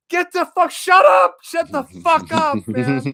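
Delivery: high, loud panicked yell; frantic producer yell. Retold or imitated. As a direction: yell down the headset to stop him. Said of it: "in the headset going".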